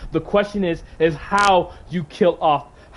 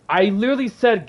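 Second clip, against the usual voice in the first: ordinary delivery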